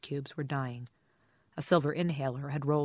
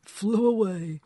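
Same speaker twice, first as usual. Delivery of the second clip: put-on giggly drunk; 5 semitones higher, 7 dB louder, slower than usual. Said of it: "He flapped both hands and giggled".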